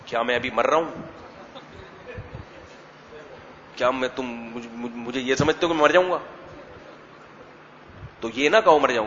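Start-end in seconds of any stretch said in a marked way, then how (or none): 0.1-6.2 a hint of a hurt old father; lightly voiced father, taken aback and a bit lower; offended father. Father answering his son about inheritance, lightly offended. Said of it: "ابا کیا کہیں گے".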